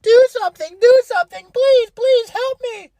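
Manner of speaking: high, squeaky wailing beg, slowing on please; mock bawling plea. Girlfriend begging her partner in court, high mock wailing. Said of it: "starts bawling her eyes out".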